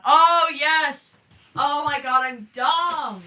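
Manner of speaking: loud, high burst of realization; sudden realization. Real, not acted. Streamer realizing chat mocked her accent, loud excited outburst. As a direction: shout it as the joke finally lands.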